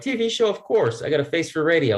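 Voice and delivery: high, eager yes; retelling his eager answer to the TV offer, excited. Retold or imitated. I'm like, eager reply.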